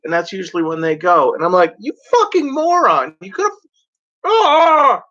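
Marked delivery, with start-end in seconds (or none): 1.8-5.0 very high furious yell ending in a cry; hammed-up driver rage; road rage shout. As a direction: scream at the moron driver.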